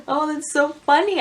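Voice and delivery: ordinary delivery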